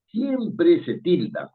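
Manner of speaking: SIEMPRE drawn out slowly and punched; stress on SIEMPRE. Shown as a demonstration. Teacher driving home the rule, SIEMPRE slow and emphatic. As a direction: stretch siempre for emphasis, then finish the rule.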